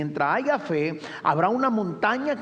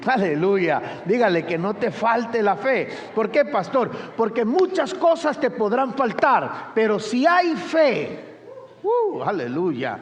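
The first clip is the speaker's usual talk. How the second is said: full-force preaching; 4 semitones higher than usual. Real, not acted. Preacher rallies congregation at full force. (predicación enérgica, a plena voz)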